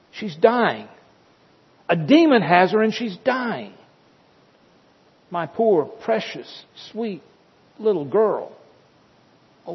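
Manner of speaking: high, pleading, breaking cries of a desperate mother; desperate begging mother. Acted. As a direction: beg for your dying daughter. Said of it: "paraphrase here maybe what she was saying or how she said it".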